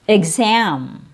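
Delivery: usual speaking voice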